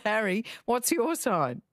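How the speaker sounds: ordinary talk, nothing marked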